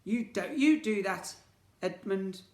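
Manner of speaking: high, loud befuddled twin voice; muddled villain twin. Acted. Twins confusing each other's names, high loud muddled bickering.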